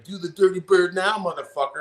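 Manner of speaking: high mocking taunt; taunting opponent. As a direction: jeer at him in a high voice, then drop it. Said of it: "And he's like".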